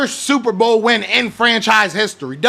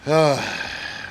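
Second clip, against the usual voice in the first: heavy sigh; 6 semitones lower, 5 dB quieter than usual. Real, not acted. Frustrated sigh alone.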